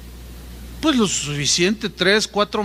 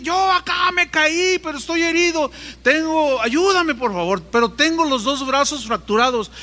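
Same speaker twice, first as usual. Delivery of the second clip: pleading for help; 8 semitones higher than usual. Retold or imitated. Injured man pleads from below.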